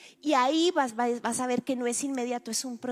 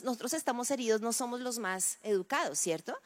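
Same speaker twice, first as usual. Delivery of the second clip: usual speaking voice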